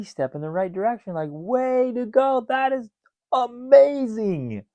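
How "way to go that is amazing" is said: high, slow, loud cheering praise; celebrating. Retold or imitated. Coach cheering a first baby step, high and gushing. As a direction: gush over them like a proud cheerleader. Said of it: "I would be celebrating".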